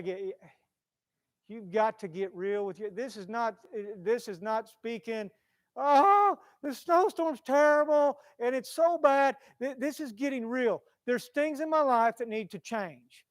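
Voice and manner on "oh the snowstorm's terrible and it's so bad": higher, louder mock whine; mock complaining. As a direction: send up empty small talk. Mocking shallow small talk.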